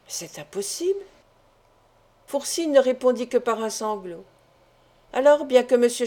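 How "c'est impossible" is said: soft, stunned murmur under the breath; a hushed, dazed murmur. A narrator's reading. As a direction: barely voice the disbelief. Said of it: "il murmura".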